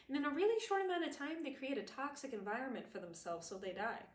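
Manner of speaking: ordinary delivery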